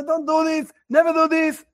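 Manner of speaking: high, urgent retold parental warning; scared scream. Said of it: "And you scream and you're scared".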